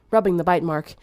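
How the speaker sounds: ordinary delivery, nothing marked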